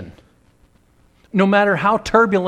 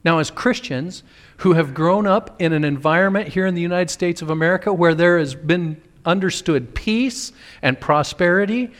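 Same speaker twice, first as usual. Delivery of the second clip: usual speaking voice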